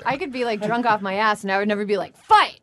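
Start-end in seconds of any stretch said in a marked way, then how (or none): 2.2-2.6 sudden high yell; shouting. Retold or imitated. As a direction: bark it out loud. Drunk woman egging on fight.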